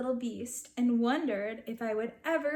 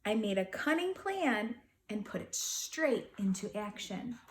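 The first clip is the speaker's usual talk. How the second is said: ordinary delivery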